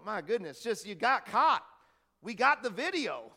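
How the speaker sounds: loud, rising mock disbelief; acted exasperation, hammering it home; exasperated. Exasperated reaction to lying politicians.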